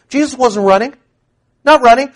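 rising preacher insistence; emphatic cry. Real, not acted. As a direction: hammer it home.

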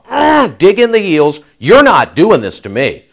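grunting, heels-dug-in refusal; stubborn refusal. Retold or imitated. Preacher voicing Peter digging in his heels, stubborn grunt. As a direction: push back like a man pulling his feet away. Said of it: "make Peter go from a".

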